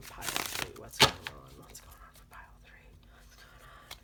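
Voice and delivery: faint whisper. Real, not acted. Reader asking the cards about pile three, whispered. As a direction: whisper the question to the deck.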